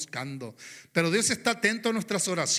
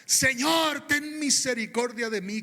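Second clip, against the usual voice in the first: loud, higher desperate cry; 6 semitones higher, 4 dB louder than usual. Retold or imitated. The Canaanite woman crying out for her daughter, loud and desperate. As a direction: call out to the Lord, begging with all your voice. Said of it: "Ella gritaba, clamaba y decía".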